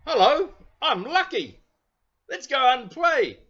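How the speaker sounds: put-on storyteller voices Lucky for children, high and eager; eager little bear. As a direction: play the bouncy toy bear itching to go out.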